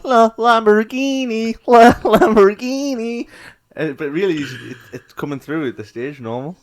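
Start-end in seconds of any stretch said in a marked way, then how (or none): 0.0-3.3 high, loud, stretched auto-tune singing; mimicked auto-tune. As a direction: belt it slowly like an auto-tuned rapper. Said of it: "the auto-tune".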